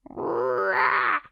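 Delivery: very high, squawking caw; a quick proud squawk like a crow. Acted. Crow ending its boast, high squawk.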